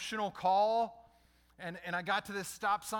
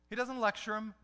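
ordinary talk

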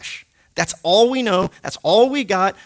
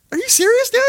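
disbelief; 4 dB louder than usual. Retold or imitated. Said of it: "And I was like".

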